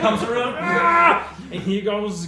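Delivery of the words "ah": battle cry. Acted.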